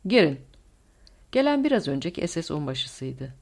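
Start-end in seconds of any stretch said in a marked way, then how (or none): 0.1-0.4 short, louder call to enter. A narrator's reading. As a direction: call to someone at the door. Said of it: "Schellenberg seslendi".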